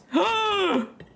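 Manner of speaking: sudden high gasp; sharp high gasp. Real, not acted. Player gasping at the game's outcome, high.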